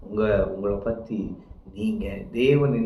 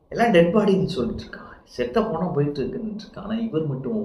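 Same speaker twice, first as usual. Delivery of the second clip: normal talking voice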